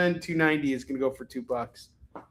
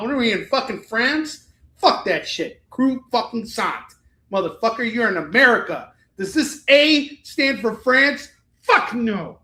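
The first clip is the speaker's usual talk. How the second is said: raised-pitch outraged tirade; hammed-up anger, punching the swear words; indignant comic rant; 6 semitones higher, 6 dB louder than usual. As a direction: rant at the pretentious Frenchness. Mock rant at etiquette coach.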